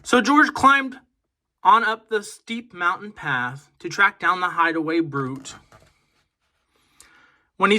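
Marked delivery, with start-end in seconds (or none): none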